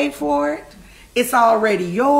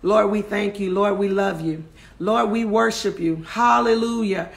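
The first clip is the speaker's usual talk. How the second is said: closing prayer; 3 semitones lower than usual. Real, not acted.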